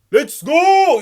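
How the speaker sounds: very high, very loud celebratory scream; screaming cheer. Retold or imitated. Fan up on their feet at turn one, screaming cheer. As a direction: scream it out in triumph. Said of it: "I was screaming".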